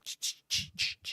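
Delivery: whispered shushing, imitating the audience; mock shushing whisper. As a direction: hiss a quick run of shushes under the breath. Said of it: "You can hear, like".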